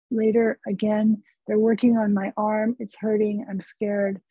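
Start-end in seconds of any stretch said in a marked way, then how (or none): none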